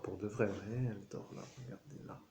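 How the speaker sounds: very soft whisper. Real, not acted. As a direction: breathe it out, cat asleep. Cat sleeping on the table.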